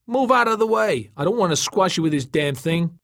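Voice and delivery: high, loud warning that eases off; warning call. A narrator's reading. Tom, landing the Packard, warns Joey below, warning call. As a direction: call to him to get clear.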